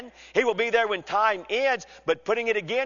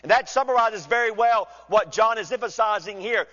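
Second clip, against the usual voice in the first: ordinary talk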